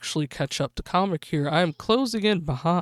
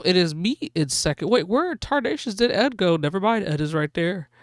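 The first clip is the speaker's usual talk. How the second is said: usual speaking voice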